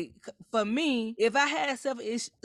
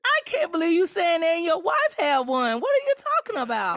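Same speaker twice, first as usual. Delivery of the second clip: loud, high, indignant outburst; outraged disbelief; 8 semitones higher, 6 dB louder than usual. Real, not acted. Co-host confronts host about his wife, outraged. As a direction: call him out at full voice.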